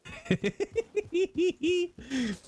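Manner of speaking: long high-pitched giggle; delighted shrill cackle; high squealing laugh. Real, not acted. Laughing at "Canadian outlier.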